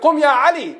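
high, loud summons; commanding call. Acted. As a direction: call the champion up to fight. Prophet calls next champion up.

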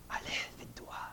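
hushed, voiceless whisper; retold phrase in a breath-only whisper. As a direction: whisper it like a teacher's secret advice. Retells the sheikh's one phrase.

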